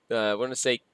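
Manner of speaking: normal talking voice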